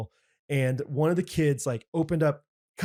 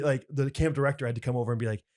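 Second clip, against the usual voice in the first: ordinary talk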